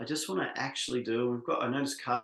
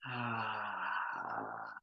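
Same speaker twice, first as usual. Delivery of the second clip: drawn-out, relaxed sigh on ah; long sighing ah; 3 semitones lower, 7 dB quieter than usual. Shown as a demonstration. Leader releasing breath in a voice exercise, long sighing ah. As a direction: let a long breath fall out as ah.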